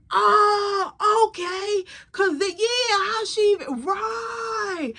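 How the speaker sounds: high-pitched exaggerated understanding; hammed-up, high voice of dawning comprehension; mock realization. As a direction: imitate someone theatrically catching on. Acted man catching on, piling on.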